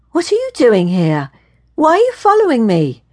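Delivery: rising to a high, loud challenge; indignant demand. A narrator's reading. Woman confronting the man who followed her, indignant.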